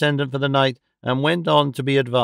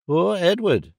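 understated sigh of pity, pitch arched high then falling; 6 semitones higher, slower than usual. A narrator's reading. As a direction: let the words sink like a sigh. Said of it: "sighed Little Dorrit".

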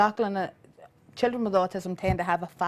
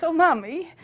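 higher, lightly voiced anxious child; worried little boy; 6 semitones higher, 4 dB louder than usual. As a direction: play the scared little boy asking Mommy. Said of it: "he goes".

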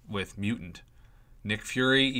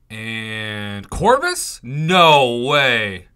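slow question, then a loud no way; stunned surprise; 11 dB louder, much slower than usual. Real, not acted. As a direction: drag it out, then burst out in disbelief. Rare champion suddenly awakened.

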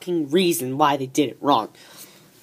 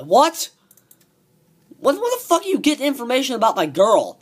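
high and fast, in disbelief; startled and defensive; surprised; 7 semitones higher than usual. Real, not acted. Surprised at friend's fact.

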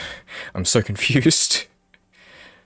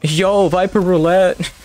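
higher, louder, slow game-show call; hyped announcement; 9 semitones higher, 5 dB louder than usual. Acted. Announcing the agent pick.

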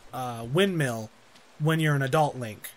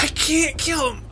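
high crying protest; anguished; 9 semitones higher than usual. Real, not acted. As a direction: whine it out in distress. Distressed at the task.

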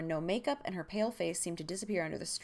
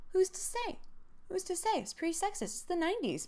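high, singsong feigned cluelessness; mock innocent; 12 semitones higher than usual. Mock shrug at book's sexism.

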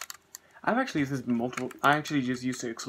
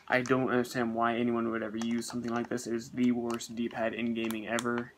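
ordinary delivery

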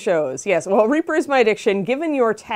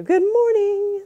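very high, slow sing-song; sing-song dog greeting; 4 dB louder, much slower than usual. Shown as a demonstration. Host showing how she greets her dog, sing-song. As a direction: chant it high and drawn out for a dog. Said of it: "what I do to my dog in the morning".

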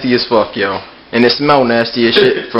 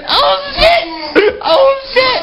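shouting; much slower than usual. Real, not acted. Reaction after trying the drink.

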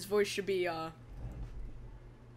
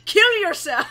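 mock yell; 10 semitones higher, 11 dB louder than usual. Mocking defendant's outburst.

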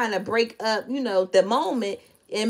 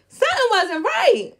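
emphatic repeat; 11 semitones higher than usual. Real, not acted.

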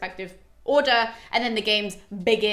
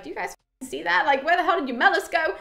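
mock yell; 6 semitones higher than usual. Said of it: "he yells out to everybody".

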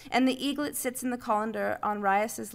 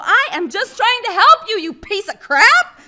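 high, loud, slowing on the insult; boy cursing the eaglet at full volume; angry yell; 11 semitones higher, 14 dB louder than usual. A narrator's reading. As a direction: spit it at the bird in fury. J J yelling at the bird.

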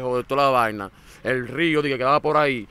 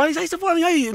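high-pitched mock lament; put-on keening, pitched way up; imitated wailing. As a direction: act out someone crying out in grief. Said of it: "No, lloró".

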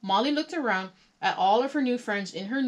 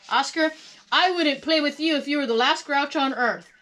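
loud, raised-pitch retort; grouchy; 6 semitones higher, 6 dB louder than usual. A narrator's reading. Molly bangs on the can, retorting grouchily. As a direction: give the grouch his own medicine. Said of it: "I can be pretty grouchy myself".

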